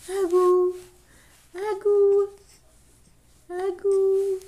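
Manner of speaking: high playful singsong. Real, not acted. Mother coaxing her baby to say bye-bye, singsong.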